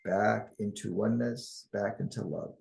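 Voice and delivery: calm, measured guiding voice with long pauses; guided meditation. Acted. Guide leading listeners into oneness and harmony, calm guided meditation. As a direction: lead them unhurriedly through the imagery.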